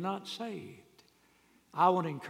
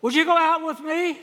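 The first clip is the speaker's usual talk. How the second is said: nervous ask; 12 semitones higher, 11 dB louder than usual. Acted. Ugly boy asks girl out.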